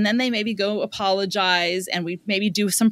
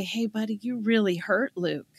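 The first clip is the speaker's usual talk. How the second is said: gentle firmness; 5 dB quieter than usual. Pretend. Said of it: "then I say".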